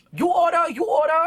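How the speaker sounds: high, loud clipped broken English; mock Chinese shopkeeper. Restaurant lady yelling at customers, high mock-accented shout. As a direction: bark it like a fed-up restaurant lady. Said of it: "She didn't speak English that much".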